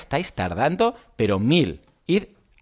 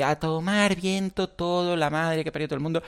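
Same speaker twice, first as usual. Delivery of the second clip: mock fed-up quit; 3 semitones higher, 4 dB quieter than usual. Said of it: "se va, y dice".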